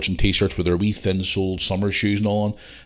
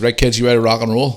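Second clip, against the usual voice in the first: louder, upbeat rallying call; rallying the kids; 3 semitones higher, 6 dB louder than usual. Retold or imitated. Dad rounding up his kids for dinner, rallying. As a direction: gee them up, a bit louder.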